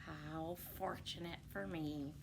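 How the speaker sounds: soft, scheming wolf aside; sly quiet aside. Acted. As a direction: murmur it to yourself.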